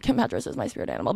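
quick mock gushing; mock guy voice. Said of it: "he was just like".

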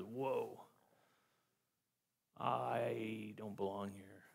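soft, slow, awestruck; hushed awe. Retold or imitated. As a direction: breathe it out as if overwhelmed before God. Isaiah's response, awed retelling.

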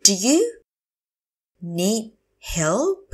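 slow teacher model of "Do you need help"; broken up slowly. Shown as a demonstration. Teacher repeats phrase word by word.

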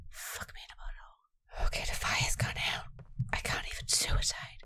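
defeated, unvoiced whisper. Real, not acted. Streamer whispering after failing the flashback. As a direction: whisper it, beaten and deflated.

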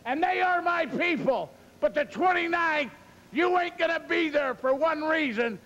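fired-up wrestling promo. Acted. Wrestler calling out Piper before the match, loud and pumped. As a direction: hype the crowd and taunt the rival.

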